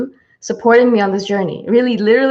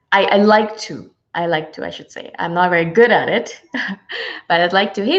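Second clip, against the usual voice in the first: ordinary talk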